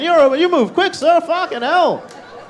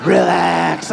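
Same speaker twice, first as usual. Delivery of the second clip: imitating girlfriend's command; 5 semitones lower, much slower than usual. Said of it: "looks me dead in the eyes, and she's like".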